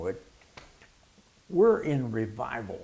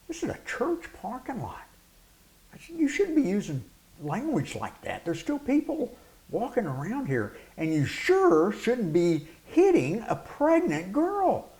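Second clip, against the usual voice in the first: higher, firm scolding; stern rebuke; 10 semitones higher than usual. Retold or imitated. Pastor confronting the man who hit his girlfriend, stern and raised. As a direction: tell him off like an outraged pastor.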